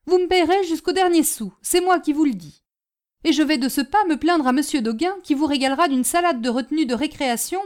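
loud, high-pitched, rushing tirade; blustering, menacing creditor; angry porter. Acted. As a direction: threaten and demand every last sou.

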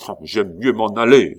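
loud exasperated outburst; exclaimed. Acted. Commandant at the gaming table, fed up, loud exclamation. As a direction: burst out, fed up with losing. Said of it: "s'écria le commandant".

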